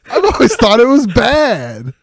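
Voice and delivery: high, laughing mock confession. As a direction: giggle it out.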